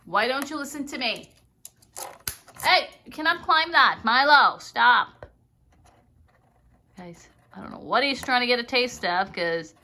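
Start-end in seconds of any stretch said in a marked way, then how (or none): 2.6-5.3 loud firm warning to a pet; raised voice telling the bird to stop; stern scolding call. Real, not acted. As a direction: call him off sharply. Scolding parrot climbing.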